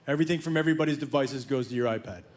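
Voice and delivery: low, quick, offhand son's explanation; imitating a casual, fast-talking son; casual rattle-off. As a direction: rattle it off low like an unbothered teen.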